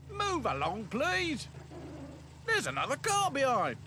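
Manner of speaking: high, called-out order; gate man. Acted. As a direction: call out to move the traffic on. Gate man hurries car.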